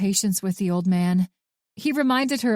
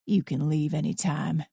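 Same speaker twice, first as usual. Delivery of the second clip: put-on gruff old man; 4 semitones lower, 3 dB quieter than usual. A narrator's reading. Arthur's voice.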